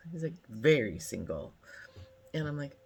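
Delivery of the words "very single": low, drawn emphasis on "Very"; mock-deep emphatic reply. Man at the pool answers if he's single, imitated low and emphatic. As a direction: imitate him, dropping low and falling. Retelling his answer to her question.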